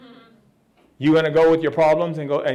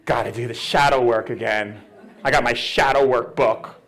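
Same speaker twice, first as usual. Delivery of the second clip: mock shadow-work devotee; 7 semitones lower than usual. Mimics shadow-work people again.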